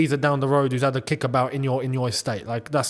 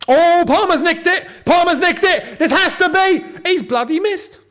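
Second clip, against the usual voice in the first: high, loud burst of match excitement; frantic football fan shout; excited shouting; 12 semitones higher, 6 dB louder than usual. Real, not acted. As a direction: yell as the chance opens up, then cry out in dismay. Live chance, then a miss.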